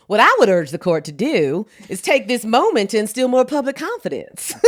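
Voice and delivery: a hint of a Southern senator, laughing at the end; Southern accent. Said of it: "So it's gotta be more like".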